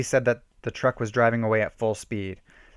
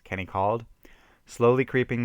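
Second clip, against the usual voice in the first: usual speaking voice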